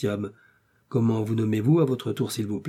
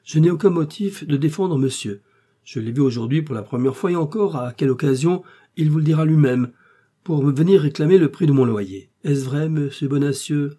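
loud, raised public declaration; showy protest, pitched up; speaking aloud; 5 semitones higher, 7 dB louder than usual. A narrator's reading. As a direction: proclaim it for the guards to hear. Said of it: "dit tout haut D'Artagnan".

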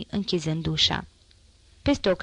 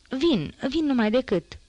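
mild higher-pitched call; calling back; 6 semitones higher than usual. A narrator's reading. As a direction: answer a summons, pitched up without shouting. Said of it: "îi strigă ea".

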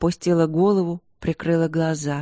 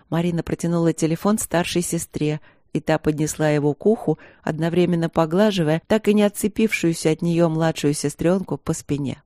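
plain reading voice

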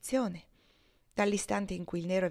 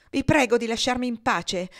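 louder, higher, coldly disdainful; haughty; 5 semitones higher, 7 dB louder than usual. A narrator's reading. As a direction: push him off with contempt. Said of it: "disse Adolfo alteramente". (tono altezzoso e sprezzante)

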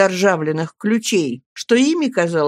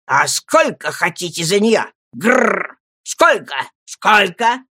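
put-on old man's shout; slower than usual. A narrator's reading. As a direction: bark the price question with a growl. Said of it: "он крикнул".

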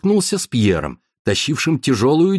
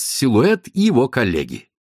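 plain reading voice

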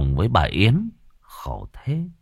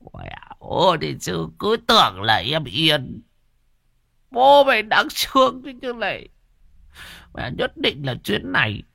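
put-on higher, wailing old man's grumble; self-pitying old man; 9 semitones higher than usual. A narrator's reading. Old man grumbling at the dog after spitting. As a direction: pity yourself, then threaten the dog.

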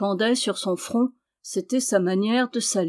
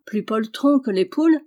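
ordinary delivery, nothing marked

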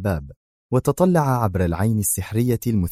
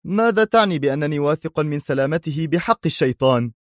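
higher, indignant question; resentful exclamation; 7 semitones higher than usual. Acted. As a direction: snap back at the doubter. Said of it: "هتف ديك في حنق".

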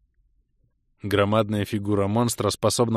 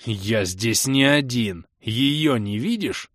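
slow, heavy indignant knight; roaring protest; much slower than usual. A narrator's reading. Crusader pointing out his companion, slow indignant protest. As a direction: protest slowly, word by word. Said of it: "взревел крестоносец".

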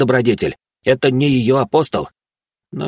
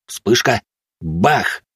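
usual speaking voice

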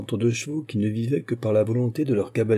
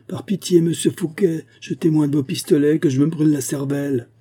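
loud, high-pitched begging; desperate plea; 7 semitones higher, 5 dB louder than usual. A narrator's reading. Dishonoured musketeer begging for a pistol, desperate plea. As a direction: beg loudly at a man riding away.

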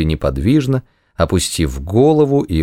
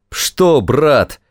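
exclaiming; 8 semitones higher than usual. Acted. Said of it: "воскликнул капрал".

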